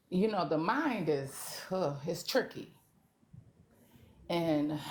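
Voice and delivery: ordinary delivery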